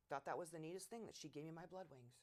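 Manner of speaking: normal talking voice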